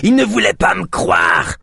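slow, high bleating cry. A narrator's reading. Little man Yosp complaining to his rescuers, bleating high. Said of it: "bêla Yosp".

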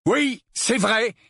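angry protest. A narrator's reading. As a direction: push back angrily against the accusation. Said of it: "protesta Harry avec colère". (protestation en colère)

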